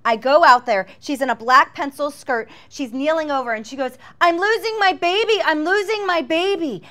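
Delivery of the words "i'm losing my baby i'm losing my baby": high, louder panicked cry; freaking out. Retold or imitated. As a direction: panic rising high in a retold outcry. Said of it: "she's freaking out".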